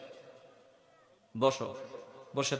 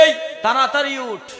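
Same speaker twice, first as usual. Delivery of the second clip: put-on higher, louder call of a mother rousing her child; 10 semitones higher, 7 dB louder than usual. Mother hurrying her son up for madrasa, raised call. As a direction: snap the sleepy boy awake as his mom.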